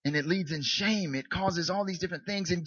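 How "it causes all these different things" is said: ordinary talk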